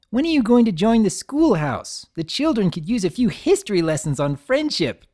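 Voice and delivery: needling the grumpy unicorn; mocking tease. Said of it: "Rainbow teased".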